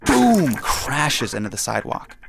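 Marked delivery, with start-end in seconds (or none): 0.1-0.6 high, loud boom; a sudden loud crash sound; loud crash word. Acted. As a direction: punch it like the crash itself.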